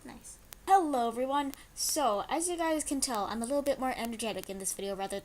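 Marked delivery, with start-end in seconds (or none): none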